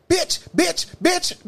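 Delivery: high repeated calling out; mock yelling. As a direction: imitate someone yelling across the bus. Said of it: "Next thing I know".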